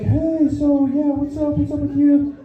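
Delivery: high-pitched mimicry of her chatting; lightly mocking high voice; mimicked woman. Voicing the woman approaching him.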